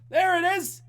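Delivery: host thrilled the chess question has finally arrived; excited cheer. Real, not acted. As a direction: shout it out in delight.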